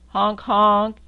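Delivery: loud, blaring honks; loud horn honk. Acted. Reading to a child, honking the jeep horn loudly.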